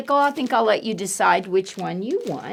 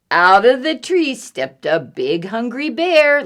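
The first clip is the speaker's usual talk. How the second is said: ordinary delivery, nothing marked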